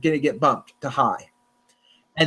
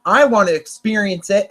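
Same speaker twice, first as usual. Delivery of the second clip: normal talking voice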